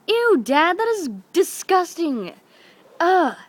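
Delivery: high, grossed-out outburst; disgusted. Retold or imitated. Daughter reacts to dad's spray tan joke, disgusted. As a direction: squeal it in exaggerated disgust.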